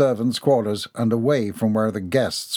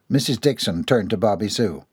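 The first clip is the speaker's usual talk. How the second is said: plain reading voice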